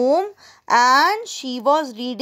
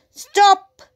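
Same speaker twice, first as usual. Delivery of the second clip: short shouted command, higher and louder; raised-voice exclamation, pitched up; 7 semitones higher, 5 dB louder than usual. A narrator's reading. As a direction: call out a sudden halt. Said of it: "Mina shouted".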